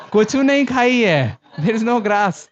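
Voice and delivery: comic donkey voice. Retold or imitated. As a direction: play a dim happy donkey. Donkey thinking it ate grass.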